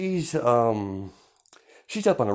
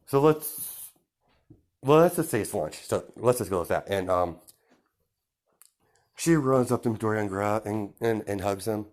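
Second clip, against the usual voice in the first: usual speaking voice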